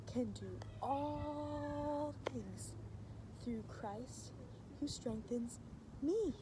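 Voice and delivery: very soft, drawn-out verse; nearly hushed, stretched words; quiet demo for children to copy; soft slow recitation. Shown as a demonstration. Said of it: "You go".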